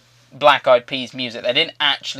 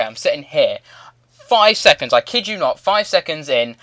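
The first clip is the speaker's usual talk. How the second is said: ordinary delivery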